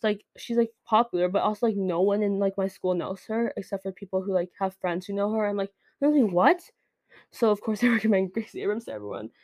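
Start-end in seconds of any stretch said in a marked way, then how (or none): 6.0-6.6 higher, louder mock disbelief; incredulous. Reacting to schoolmates not knowing the singer, incredulous. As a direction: act out a startled double-take.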